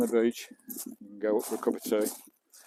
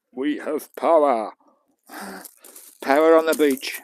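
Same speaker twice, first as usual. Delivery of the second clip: drawn-out, louder cheer that the power works; proud, louder call of success; pleased announcement; 4 semitones higher, 8 dB louder, slower than usual. Real, not acted. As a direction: announce it like a small victory. Power station finally switched on.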